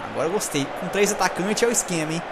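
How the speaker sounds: ordinary talk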